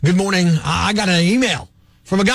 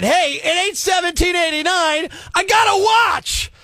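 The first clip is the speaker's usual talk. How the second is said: ranting shout; 7 semitones higher than usual. Acted. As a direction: hammer the point home with a shout. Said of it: "let me say it again".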